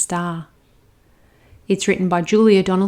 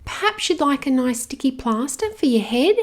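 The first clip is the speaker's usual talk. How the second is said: put-on little girl; 6 semitones higher than usual. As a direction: soothe the hurt dragon like a little girl. Girl offers plaster.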